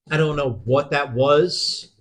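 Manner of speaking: ordinary talk, nothing marked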